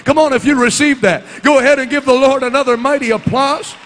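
loud, ringing preacher's call; preaching at full force. Real, not acted. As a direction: lift the whole room with it.

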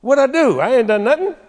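high, loud protest of innocence; indignant teenager. Acted. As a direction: defend yourself like a wronged kid. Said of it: "he said".

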